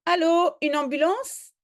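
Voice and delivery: high, loud allô into the phone; urgent phone call. Acted. Chief calls loudly for an ambulance.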